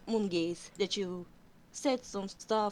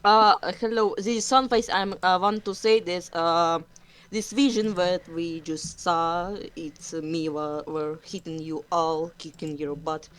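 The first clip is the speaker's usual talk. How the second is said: loud, earnest cat apology; Sunface in character; 7 dB louder than usual. Acted. Cat warrior apologizing to the group, loud and earnest. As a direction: boom out a formal in-character apology.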